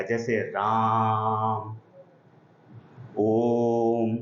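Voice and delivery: राम and ओम with the final vowel held very long and slow; prolonged pluta vowel. Shown as a demonstration. Teacher showing students the three-beat vowel, held very long. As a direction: stretch the vowel of राम, ओम out like a chant. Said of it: "प्लुत स्वरों को बोलने में तीन second".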